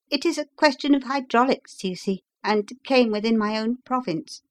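high-pitched visitor's voice; engineer patient. Acted.

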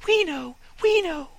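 very high chirpy little sparrow voices; excited twittering sparrows; chirping sparrows. Acted. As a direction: chirp it like eager little birds.

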